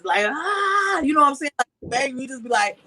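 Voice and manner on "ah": short high imitated scream; a quick high mock shriek. Mother showing how she screamed at the father, a short high mock scream. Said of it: "She saw me screaming to the".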